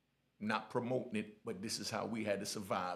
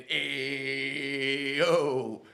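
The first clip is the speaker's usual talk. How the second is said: loud, long-held "ayo"; singsong yell; 3 semitones higher, 8 dB louder than usual. Retold or imitated. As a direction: holler it out like a sung chant. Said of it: "I'm yelling".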